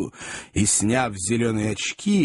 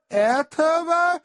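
very high, drawn-out lady's voice; high-pitched wavering start; flustered lady; much slower than usual. A narrator's reading. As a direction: begin a refusal, stretching the word. Lady begins her refusal.

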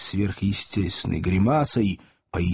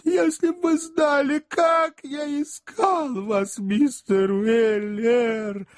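gushing sycophant; 10 semitones higher than usual. A narrator's reading. Job gushes fake devotion.

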